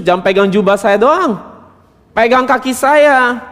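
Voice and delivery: high-pitched, urgent mock pleading; hammed-up hysterical urging; hysterical begging demo. Shown as a demonstration.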